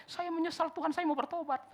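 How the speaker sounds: high, pleading churchgoer voice; a mock plea to God, voiced high; imitating a repentant believer. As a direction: play the sinner begging God. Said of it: "saudara-saudara kalau bilang".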